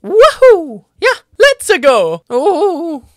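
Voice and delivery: put-on lightly Italian accent, Mario cheer; a hint of Italian plumber.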